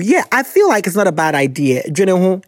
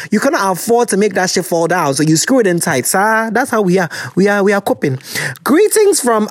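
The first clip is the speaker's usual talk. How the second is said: ordinary talk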